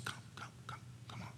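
breathy whisper, almost silent; a whispered, loving come-here; tender whisper. Acted. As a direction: call them home in a hush. God calling the lost child home.

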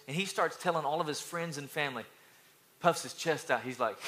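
ordinary talk, nothing marked

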